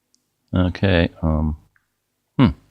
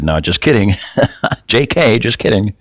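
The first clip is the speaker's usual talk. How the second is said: playful take-back; 6 semitones higher than usual. Retold or imitated. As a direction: bounce it back up as a joke. Retracting a teasing quote to Jeff.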